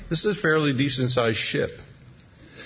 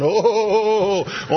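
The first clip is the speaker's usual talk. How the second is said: wobbling on the waves; 7 semitones higher than usual. Retold or imitated. Mock Jesus staggering on the waves, hammed-up wobbly oh's. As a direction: ham up a man struggling for balance. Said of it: "Jesus is on the waves going".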